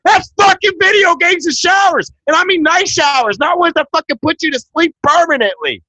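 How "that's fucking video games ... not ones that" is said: loud, high, furious shouting; heated shouting. Real, not acted. Arguing friend shouts him down in anger. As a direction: yell it at him in outrage. Heated argument about concentration camps.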